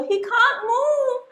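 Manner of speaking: high, worried questions; fretting for the dying whale; anxious high voice; panicked snail. Acted. Snail panics over beached whale.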